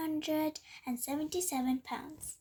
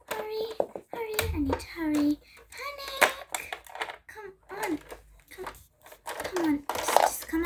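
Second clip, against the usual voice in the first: panicked hurry; 6 semitones higher than usual. Acted. Cat panicking to get inside.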